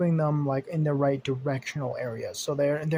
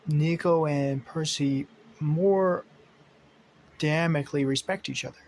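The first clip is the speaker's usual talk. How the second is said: usual speaking voice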